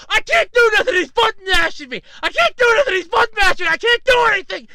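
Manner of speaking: high, loud mock-panicked yelling; hammed-up frantic complaining; frantic protest. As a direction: imitate a gamer helplessly wailing his excuse. Acts out the losing player's protest.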